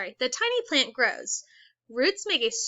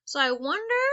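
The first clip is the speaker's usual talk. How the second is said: usual speaking voice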